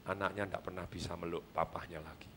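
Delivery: sad hush. Acted. Sad story ending, very quiet.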